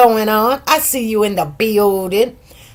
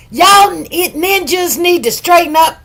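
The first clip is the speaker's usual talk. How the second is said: mock telling-off; 6 semitones higher than usual. Guessing how the judge told everyone off, mock scolding. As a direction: scold the whole group, raised pitch. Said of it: "Did she say it like that?".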